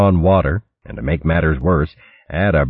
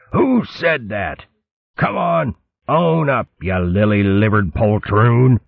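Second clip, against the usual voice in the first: higher, slow furious pirate challenge; yelling fox captain; 6 semitones higher than usual. Acted. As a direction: dare the culprit to own up. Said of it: "he yelled".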